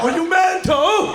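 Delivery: high, loud, drawn-out British outrage; mock British accent. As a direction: belt it out in a broad British accent. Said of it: "I get the accent".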